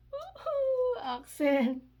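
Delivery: very high, loud woohoo; elated whoop. Real, not acted. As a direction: cheer the sender's name in a squeal. Cheers the sender after seeing receipt.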